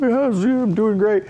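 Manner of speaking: high, loud forced-casual voice; fake fine. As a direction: squeak out that you're totally fine. Stoned, pretending to be fine.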